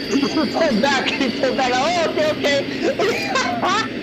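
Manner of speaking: high, laughing through the words; laughing at the clip in disbelief. Real, not acted. Laughing while watching the clip.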